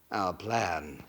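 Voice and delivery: low hushed scheming. Acted. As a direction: lean in and drop the voice.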